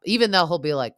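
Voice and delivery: ordinary delivery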